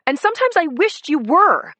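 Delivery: high, loud and vehement. A narrator's reading. Wife lashes out at her husband, high loud vehemence. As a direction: spit it out at him with force. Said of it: "I said it with vehemence".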